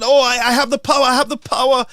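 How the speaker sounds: high, fast mocking boast; mocking the speaker. Mocking the House speaker, high fast gloating boast. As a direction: lord it over the room like a petty tyrant. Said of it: "The speaker said".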